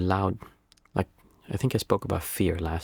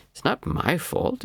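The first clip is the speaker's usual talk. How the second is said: "It's not my fault" said higher, slower and whining; mock whiny excuse; 8 semitones higher, slower than usual. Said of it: "wanting to make excuses".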